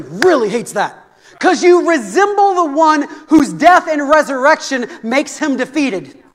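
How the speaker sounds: loud, driving sermon voice; fiery preaching. Acted. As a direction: preach it with full force. Preaching at full force.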